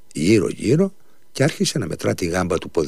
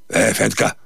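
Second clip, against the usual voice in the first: calling out to servant; 3 semitones higher, 3 dB louder, slower than usual. A narrator's reading. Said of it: "φώναξε στο βαστάζο του".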